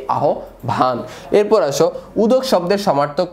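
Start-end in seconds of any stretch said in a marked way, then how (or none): 0.1-1.0 আহ্বান lowered, as "aho-bhan"; measured "aho-bhan" for আহ্বান; standard pronunciation. Shown as a demonstration. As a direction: say আহ্বান in two parts, aspirated "bh". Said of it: "আহ্বানের প্রমিত উচ্চারণ কি".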